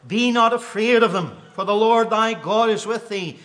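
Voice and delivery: high, loud preacher's cry building to a peak; fervent preaching. Acted. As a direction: preach it out with rising fire. Preaching the scripture aloud.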